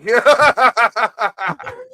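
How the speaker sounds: real high-pitched cackling laugh; loud high laugh. Real, not acted. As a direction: burst out in high, ringing laughter.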